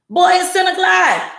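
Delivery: put-on boy, it's late already; exasperated mother. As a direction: drag it out like a fed-up mom. Mother answering annoyed son.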